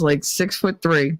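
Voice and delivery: usual speaking voice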